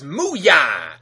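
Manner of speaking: loud, punchy cow-warrior yell; hammed-up loud cry; mock battle cry. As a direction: belt it out like a goofy war cry. Cyborg's battle cry retold.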